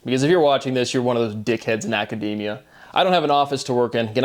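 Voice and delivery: usual speaking voice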